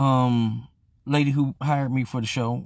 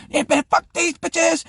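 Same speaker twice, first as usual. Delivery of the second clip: high, loud, drawn-out holler; imitated angry yell; 5 dB louder, slower than usual. Kato still cursing the women out, imitated yell. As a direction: yell it slow and high as the raging friend.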